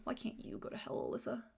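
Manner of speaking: hushed, unvoiced snarky jab; sarcastic aside. Real, not acted. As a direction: mutter it under your breath at the character.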